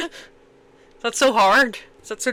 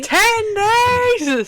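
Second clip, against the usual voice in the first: high, drawn-out disbelief; incredulous exclamation; 10 semitones higher, 3 dB louder than usual. Real, not acted. Co-host hears about the actor's short shoot, incredulous. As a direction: exclaim it high, stretching the words.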